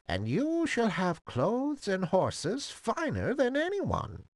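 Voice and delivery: put-on high, swooping old-woman croak; old fairy godmother. A narrator's reading. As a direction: command kindly as a high-voiced old fairy. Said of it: "in a feeble, croaking voice".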